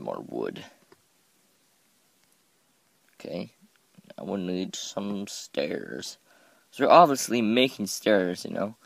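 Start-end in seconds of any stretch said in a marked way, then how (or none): none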